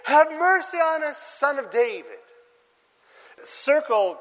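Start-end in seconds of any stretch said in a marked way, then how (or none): 0.1-2.2 high, loud plea for mercy; crying out. A narrator's reading. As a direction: beg loudly like blind men by the road. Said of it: "they cried out".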